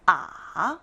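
아 dipping low, then rising; third tone. Shown as a demonstration. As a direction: sink 아 to the bottom and bring it back up. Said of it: "삼성입니다".

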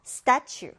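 "statue" stressed on STA; first-syllable stress. Shown as a demonstration. Teacher introducing a new word, "statue" with stress on the first syllable. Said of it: "첫 음절에 강세를 두고요".

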